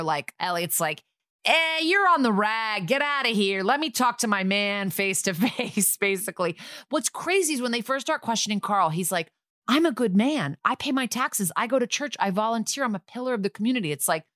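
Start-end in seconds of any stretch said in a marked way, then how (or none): 1.4-6.0 mock misogynist cop. Detective playing misogynist to win over the suspect, mock jeering. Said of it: "misogynist thing together where". 9.7-13.9 higher-pitched indignant recital of virtues; mock self-righteous protest. Suspect defending himself under questioning, mock indignant.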